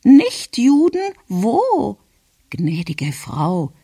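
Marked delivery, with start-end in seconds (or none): 0.0-2.0 very high, loud query; startled grandmother. A narrator's reading. Grandmother reacts to the delegation's words, startled and high. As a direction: shoot the pitch up in alarm.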